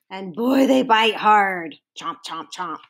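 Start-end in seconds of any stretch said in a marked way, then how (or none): 2.0-2.8 quiet chomping. Acted. Biting sound words.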